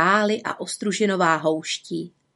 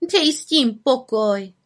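higher, slow, peeved brush-off; grumpy; 4 semitones higher than usual. A narrator's reading. Little witch brushes off the raven's scolding, grumpy. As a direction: drag it out crossly. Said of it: "vykřikla mrzutě".

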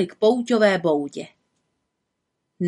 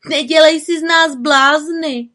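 higher, louder protest; loud high protesting call; 7 semitones higher, 6 dB louder than usual. A narrator's reading. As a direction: call it out mockingly. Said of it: "volali Eskymačky".

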